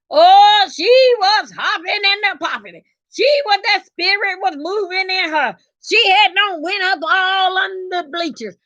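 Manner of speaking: high, rousing church cadence; church-style testifying. Retold or imitated. Wife recounting sister catching the Holy Ghost, testifying. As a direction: whoop it up like a revival witness.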